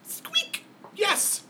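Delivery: very high excited squeak; very high, eager squeak then yes; hamster squeak. Acted. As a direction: pipe up like a tiny hamster. Hamster answering.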